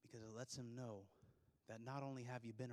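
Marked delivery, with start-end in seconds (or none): none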